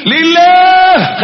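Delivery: long, high, loud call; drawn-out high answer. Acted. Preacher calling out the answer to his congregation, long and high.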